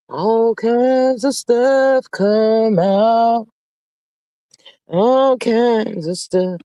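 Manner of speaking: stretched-out, slow and deliberate; slow, knowing gossip drawl. Real, not acted. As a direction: let each word hang.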